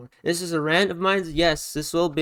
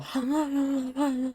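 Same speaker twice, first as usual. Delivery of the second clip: imitated squeaky vocal; 11 semitones higher, 4 dB quieter than usual. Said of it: "it's gonna go".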